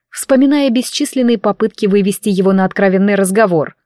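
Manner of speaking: plain reading voice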